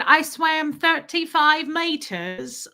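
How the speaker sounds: put-on high, slow British little-boy voice; stretched-out, singsong British kid accent. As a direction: imitate a proud British child, drawn out. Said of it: "He said".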